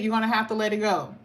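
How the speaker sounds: ordinary talk, nothing marked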